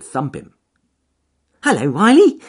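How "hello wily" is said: high, loud cheerful bunny; cheerful rabbit voice. Acted. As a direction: call up brightly to the trees. Rabbit greets weasel.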